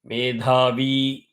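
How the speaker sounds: "मेधावी" with the final वी held long; long final vowel. Shown as a demonstration. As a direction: stretch the वी at the end of "मेधावी". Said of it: "V is दीर्घम".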